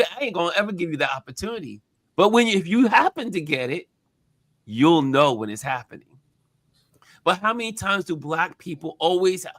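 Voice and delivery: ordinary delivery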